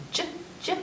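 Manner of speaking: unvoiced J, J for soft G; soft G sound. Shown as a demonstration. Teaching the soft G as j. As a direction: breathe the J sound. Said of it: "its soft sound is".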